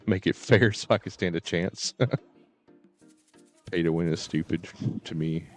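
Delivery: ordinary talk, nothing marked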